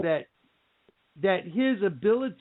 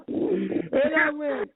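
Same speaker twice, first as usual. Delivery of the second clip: normal talking voice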